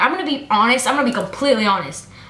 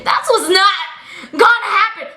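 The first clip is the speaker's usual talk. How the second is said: raised, emphatic outburst; angry refusal; 6 semitones higher than usual. Retold or imitated. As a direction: let the refusal burst out higher. Repeats refusal, getting mad.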